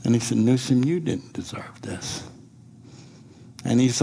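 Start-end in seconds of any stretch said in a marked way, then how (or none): 0.4-2.2 hushed, breathless apology; tearful whisper. Retold or imitated. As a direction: whisper it through tears. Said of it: "he started crying".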